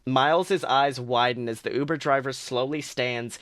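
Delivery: ordinary delivery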